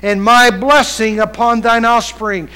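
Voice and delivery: loud, drawn-out pulpit delivery; preaching. Acted. Preacher reading scripture to congregation, preaching it out.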